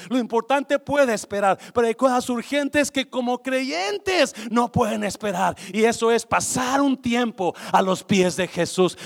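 loud, high fiery preaching; preaching at full force. Acted. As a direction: drive it home to the congregation. Prédica a todo volumen. (predicación a gritos)

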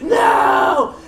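loud hammed-up cry of no; mock shout. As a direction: yell no in mock despair. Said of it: "And he's like".

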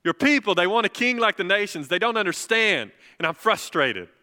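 high, loud, exasperated appeal to God; frustrated plea. Retold or imitated. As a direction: voice Samuel pleading loudly in frustration. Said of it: "in frustration".

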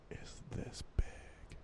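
hushed under the breath; whispering. Real, not acted. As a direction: whisper it as a joke. Mocking short attention span.

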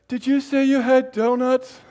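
put-on high, loud, eager child's question; a kid who only heard "donuts". Kid asks about donuts.

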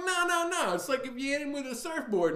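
high-pitched, insistent retort; mock exasperated correction. Bruce Willis coaching his partner, high mock exasperation.

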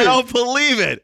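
can't believe it's Rudy; stunned disbelief. Real, not acted.

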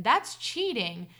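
usual speaking voice

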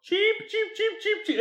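high mock chick peeping; chicken noise. Retelling the guys teasing Peter, high peeps. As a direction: peep like a silly chick. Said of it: "the other three guys start going".

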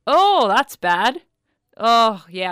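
loud, slow and breezy, brushing it off; dismissive. Retold or imitated. Brushing off her partner's shock on the phone, dismissive and breezy. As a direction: wave it away like it's nothing. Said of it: "That's the way I was talking".

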